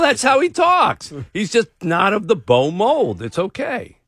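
ordinary talk, nothing marked

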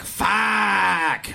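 short pained outburst; dismayed curse. Retold or imitated. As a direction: blurt it out in dismay.

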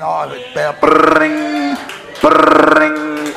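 slow rolled phone ring; phone ring imitation. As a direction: roll the r like a phone ringing.

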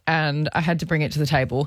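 usual speaking voice

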